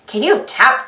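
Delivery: plain reading voice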